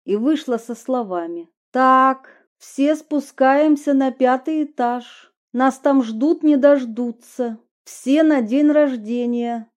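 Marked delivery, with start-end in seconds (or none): 1.7-9.7 higher, louder cheerful order; rally the guests upstairs; bright bossy call. Acted. Heroine voiced bossy, flirty.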